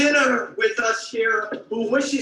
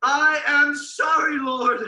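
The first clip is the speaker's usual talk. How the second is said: loud preaching; 5 semitones higher, 3 dB louder than usual. Acted. Preacher leading shouted confession.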